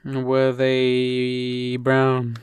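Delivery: very slow, drawn-out guessing question; Akinator. Acted. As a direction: stretch it like a genie divining the answer. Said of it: "I'm doing the Akhenaten".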